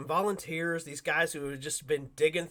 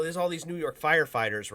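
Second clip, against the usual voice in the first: usual speaking voice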